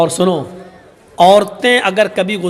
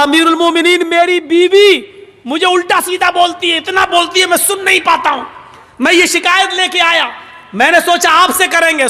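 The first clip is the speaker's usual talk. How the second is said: high, raised, half-laughing grievance; agitated complaint; 7 semitones higher than usual. Acted. Young man complaining to Umar about his wife, high agitated. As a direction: blurt out the whole grievance.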